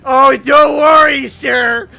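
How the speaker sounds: loud, slow, sugary-sweet fake courtesy; smiling sarcastic politeness. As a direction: smile and pretend to be helpful. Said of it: "I looked at him and I smiled".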